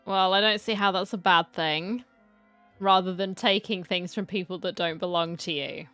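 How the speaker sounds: usual speaking voice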